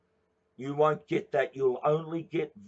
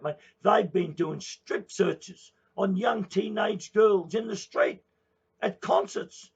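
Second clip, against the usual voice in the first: raised, higher-pitched angry rant; heated rant; 6 semitones higher, 4 dB louder than usual. Real, not acted. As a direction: build into a furious public rant. Ranting about police searches.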